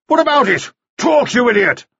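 high, impatient shouting; barked demand. A narrator's reading. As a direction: order the boy to talk, furious. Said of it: "Mannering barked".

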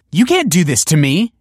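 high, loud protest; crying out. A narrator's reading. Pupil backing away from straitjacket, crying out in protest. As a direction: cry out in indignant alarm. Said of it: "she cried".

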